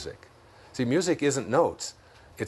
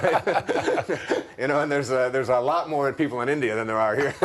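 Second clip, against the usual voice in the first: laughing; 3 semitones higher than usual. Real, not acted. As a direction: talk while laughing at your own joke. Laughing at his own joke.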